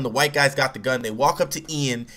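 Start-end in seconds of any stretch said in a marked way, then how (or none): none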